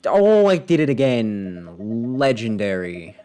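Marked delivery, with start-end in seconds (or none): none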